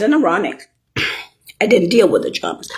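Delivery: ordinary talk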